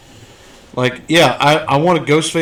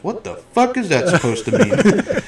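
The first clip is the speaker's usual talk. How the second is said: quoting Captain Spaulding; 6 semitones higher than usual. Retold or imitated. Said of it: "how Captain Spaulding says".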